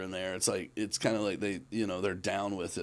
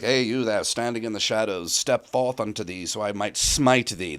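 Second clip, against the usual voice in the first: put-on old English voice; 6 dB louder than usual. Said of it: "I can do the old English stuff pretty good".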